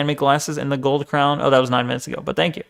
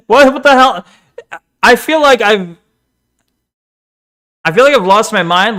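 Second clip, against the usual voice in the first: incredulous outburst; 5 semitones higher, 5 dB louder than usual. Real, not acted. Reacting to Rev's squeaky voice.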